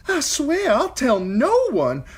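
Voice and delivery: high, loud, slow desperate vow; terrified singer swearing silence; pleading singer. A narrator's reading. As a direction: beg for your life. Singer begs the Hand.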